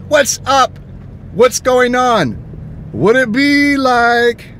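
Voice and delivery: high, slow drawn-out mock greeting. Imitating a stranger across a store, slow high greeting. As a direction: stretch out a cartoonish what's up. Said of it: "Without saying".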